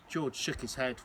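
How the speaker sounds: ordinary delivery, nothing marked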